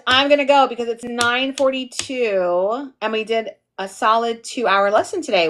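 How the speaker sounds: ordinary talk, nothing marked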